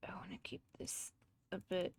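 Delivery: whispered aside; whispering. Real, not acted. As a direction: whisper it under breath. Whispering to self.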